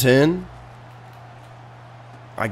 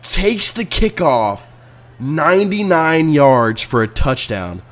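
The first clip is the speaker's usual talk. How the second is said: mock announcer; 4 semitones higher, slower than usual. Mock replay narration of disaster.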